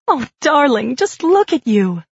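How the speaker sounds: lightly voiced wife rushing to her husband; a hint of a doting young wife; fussing young wife. A narrator's reading.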